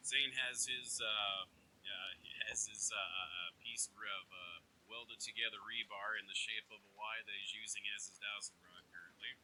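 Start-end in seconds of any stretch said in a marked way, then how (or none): none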